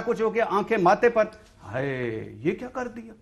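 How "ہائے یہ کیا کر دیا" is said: a soft, sighing hai, mocking their dismay; half-whispered fake lament after the fact. Mocking the party's dismay after the sit-in, soft.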